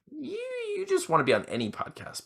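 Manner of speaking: high mocking sing-song. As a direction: tease in a high voice. Mocking eager guests.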